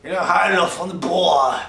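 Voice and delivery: loud, hammed-up drunken British accent; bad British accent. Shown as a demonstration. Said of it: "so, bad accent".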